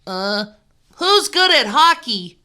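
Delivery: put-on a boy asking the class around; Tyler's voice. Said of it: "asked Tyler".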